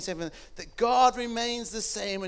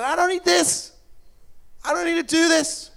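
high, loud cry of protest; imagined protest; 9 semitones higher, 11 dB louder than usual. Retold or imitated. Preacher voicing Jesus' possible refusal on the road, loud protest. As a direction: cry out against it, pitch and volume leaping up.

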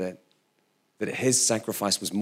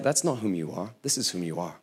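tender God voice; 5 semitones lower, 6 dB quieter than usual. Retold or imitated. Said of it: "He's like".

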